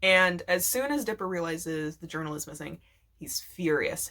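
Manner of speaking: normal talking voice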